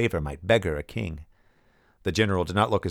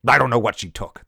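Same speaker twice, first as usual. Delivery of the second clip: louder, sharp retort from the general; snapped; 4 semitones higher, 7 dB louder than usual. A narrator's reading. General snapping at scholars about lost memories, louder. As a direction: bite off the words, irritated. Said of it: "the general snapped".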